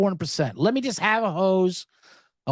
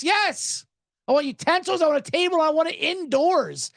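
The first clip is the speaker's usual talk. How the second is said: mock indignant; 7 semitones higher than usual. Mock reply to the hostess.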